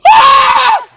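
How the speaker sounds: high startled shriek; scared scream. Acted. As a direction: shriek in fright at the noise. Frightened kid at storm.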